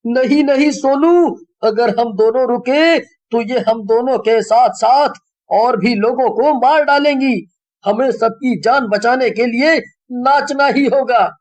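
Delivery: put-on high, pleading boy voice; urgent boy. A narrator's reading. Monu urging tired Sonu to keep dancing. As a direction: urge your friend to keep going. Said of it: "Sonu Monu से कहता है".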